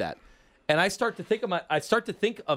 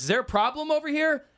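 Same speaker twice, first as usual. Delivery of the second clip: village cop; 10 semitones higher than usual. Acted. Cop pulls up on the shakedown, high-pitched mock-official query. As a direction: ask it higher, like a cop butting in. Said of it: "So the Bay Village cop".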